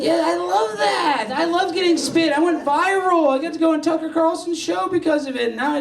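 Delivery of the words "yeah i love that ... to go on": high, loud, laughing through the words; cracking up while he jokes. Real, not acted. Joking about his spitting fetish, high and laughing loudly.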